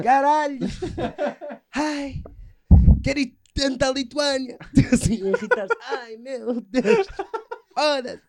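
high, excited, put-on swooning voice; mock travel junkie. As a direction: ham up a gushing travel addict. (viciado em viagens exagerado, agudo e afetado)

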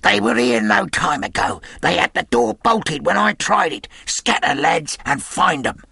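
high, agitated yelling; frantic commanding shout; urgent shouted orders. A narrator's reading. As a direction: spur the gang into a search. Pew shouts orders at gang.

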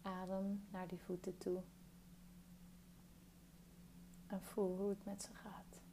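calm meditation guide, long pauses between phrases; guided meditation. Real, not acted. Guiding a listener through a body scan, calm meditation voice.

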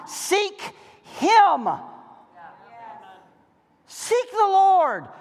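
"Seek the Lord" drawn out very slowly; solemn slow proclamation; slow emphasis. Acted. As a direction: lay weight on every word. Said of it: "It's just one".

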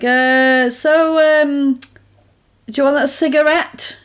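mock a bad actor feigning casualness; imitating awkward, obvious acting; terrible acting. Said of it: "So then terrible acting ensues".

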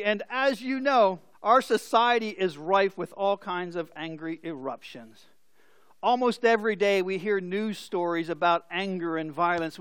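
ordinary talk

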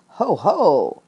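loud, mild booming gloat; a hint of a gloating monster; heffalump voice. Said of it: "in the sort of voice this heffalump".

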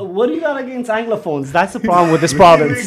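hammed-up grievance, half laughing; mock anger. Guest mock-ranting about the province, hammed-up anger. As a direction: play at being fed up, loud and jokey. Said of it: "Il est fâché".

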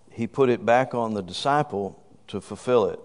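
usual speaking voice

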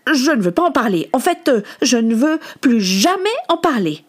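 put-on upset boy digging in; angry refusal. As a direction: shut the subject down crossly. Boy angrily refuses the subject.

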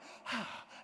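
voiceless exhaled sigh; acted gasp of relief. Man bursting out of the crowd, sighing out. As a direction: let out a big breath, mock-relieved.

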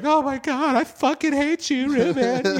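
high, loud imitation of a crying friend; mock upset girl. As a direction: shriek it as the upset girlfriend. Crying girl yelling at him.

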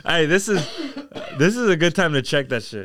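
spluttering after a wrong swallow; coughing up water. Real, not acted. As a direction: talk through the cough. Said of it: "This shit went down the wrong pipe".

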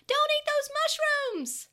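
high, loud, slow creature shout; frantic scream. Acted. Koromon warning the boys off the mushrooms, screaming. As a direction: scream a warning with all you have. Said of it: "Koromon continued screaming".

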